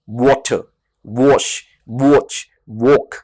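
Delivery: वाटर, वॉश, वॉच, वॉक with rounded lips on the w; rounded w sound. Shown as a demonstration. Teacher demonstrates w words to students: rounded lips. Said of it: "अपने lips को और अपने टंग को रोल".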